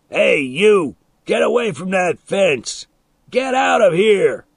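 put-on loud, high, furious yell at a kid; angry shout. A narrator's reading. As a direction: bellow him off the fence. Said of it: "when I heard Milo shout".

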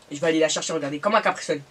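sandwich at the lips; talking while eating. Real, not acted. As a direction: mouth partly full. Seen 1: Holds sandwich at face; at 4.7–5.9 mouth is on sandwich.

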